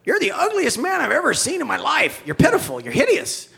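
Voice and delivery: high, loud squawking jeers; insulting parrot. Acted. Parrot insulting a customer, shrieky high squawk. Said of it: "the parrot says".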